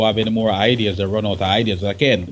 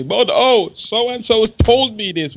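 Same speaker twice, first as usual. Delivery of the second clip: high, mock whiny journalist voice; 9 semitones higher, 4 dB louder, slower than usual. Scientist mocking a journalist's excuse, high whine.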